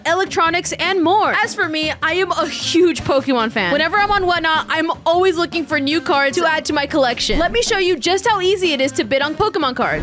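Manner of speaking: upbeat sponsor read. Acted. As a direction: sell it like a sponsor spot. Reads a sponsor ad.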